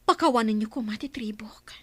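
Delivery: hushed whisper of apology; whispered remorse to the chief; whispered plea. Acted. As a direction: beg forgiveness in a whisper. Daughter begs forgiveness for father.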